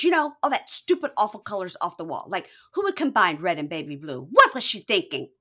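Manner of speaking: scornful, punchy mock rant; indignant girlfriend. Girlfriend criticizing the ex's wall colors, indignant.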